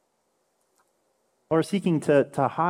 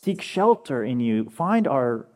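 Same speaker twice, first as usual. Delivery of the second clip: earnest plea; much slower than usual. Pretend. Deep request, slow and earnest.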